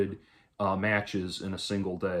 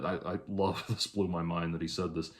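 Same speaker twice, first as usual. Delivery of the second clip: ordinary talk, nothing marked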